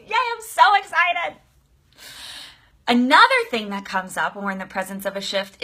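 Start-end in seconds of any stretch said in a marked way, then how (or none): 0.1-1.4 high, slow gushing yay; excited. Acted. Coach reads out a fan comment, high excited squeal. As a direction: gush back at a viewer's comment. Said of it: "Very excited".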